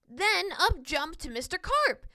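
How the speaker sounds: ordinary delivery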